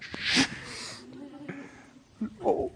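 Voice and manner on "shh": long, sharp shush; parents' shushing. Acted. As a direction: hush a child in public. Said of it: "And your parents are like".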